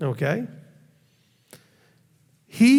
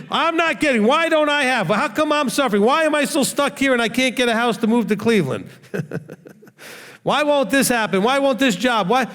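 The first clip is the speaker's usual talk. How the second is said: self-centred whining; 8 semitones higher than usual. Retold or imitated. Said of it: "start looking at it about you".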